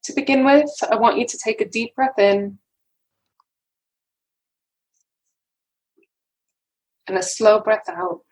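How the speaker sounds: unhurried phrases, leaving room to breathe; guided meditation. Acted. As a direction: guide listeners gently into calm, breath by breath.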